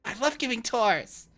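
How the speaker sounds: high, loud, eager volunteering; overexcited character voice; giddy. Acted. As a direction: jump at the chance with squealing delight. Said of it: "are both all giddy".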